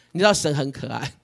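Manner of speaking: normal talking voice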